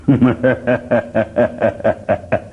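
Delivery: long staccato villain laugh; slow-building pulsed stage laugh; S V Ranga Rao. Acted. Mimic as an actor playing Chanakya, long derisive laugh. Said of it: "Rangarao గారు చాణక్యుడు వేస్తే".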